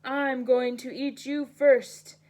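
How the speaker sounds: loud troll threat. Acted. Troll threatens to eat the goat, loud. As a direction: roar it like a hungry troll. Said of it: "shouted the troll".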